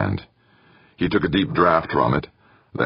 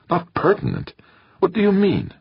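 much higher, stunned outburst; shocked disbelief. A narrator's reading. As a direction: recoil at the news in disbelief. Molina stunned by accusation.

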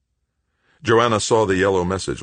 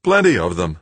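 strained, louder weak voice; straining to shout; 2 semitones higher, 6 dB louder than usual. A narrator's reading. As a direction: force it out as loud as possible. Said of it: "raising his voice as loud as he could".

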